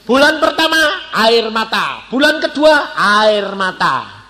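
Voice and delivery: loud, drawn-out lament; dramatic build-up. Real, not acted. Preacher counts months of tears before demotion, dramatic. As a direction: stretch each phrase out slowly and high.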